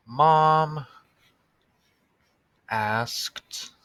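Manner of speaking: word by word, long pauses between; slow dictation. Shown as a demonstration. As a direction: dictate each word slowly while writing. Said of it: "writing while reading aloud".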